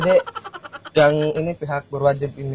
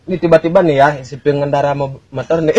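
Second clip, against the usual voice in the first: ordinary delivery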